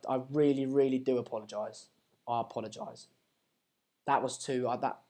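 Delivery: hushed, low, stumbling sorry; subdued apology. Real, not acted. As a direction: apologise quietly after an embarrassing outburst. Apologising after shouting at stream.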